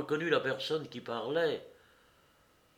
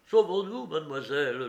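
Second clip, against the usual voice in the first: higher, louder burst of alarm; alarmed urging; 4 semitones higher, 6 dB louder than usual. A narrator's reading. Courrapier begs mademoiselle to flee, alarmed. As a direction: urge her to flee at once.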